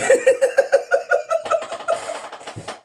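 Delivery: high unvoiced wheeze of laughter; breathless laugh. Real, not acted. Streamer wheezing with laughter at the story, high breathless laugh.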